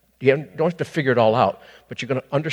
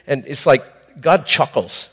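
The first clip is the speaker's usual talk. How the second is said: ordinary talk, nothing marked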